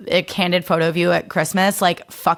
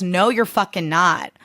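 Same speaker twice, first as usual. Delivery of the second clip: ordinary delivery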